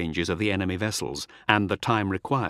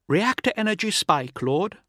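high-pitched bridge officer alerting her lord; higher voice, lifting on the address; female officer's alert; 9 semitones higher than usual. A narrator's reading. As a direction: report the spike in a high, composed voice. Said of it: "her words quick but calm".

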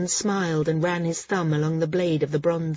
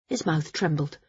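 ordinary delivery

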